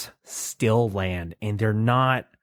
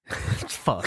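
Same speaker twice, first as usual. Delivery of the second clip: laughing; 7 semitones higher than usual. Real, not acted. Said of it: "I started that with no knowledge".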